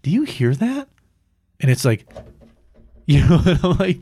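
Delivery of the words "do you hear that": high, rising query; puzzled question in a raised voice; retold puzzled question. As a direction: ask it pitched right up, puzzled.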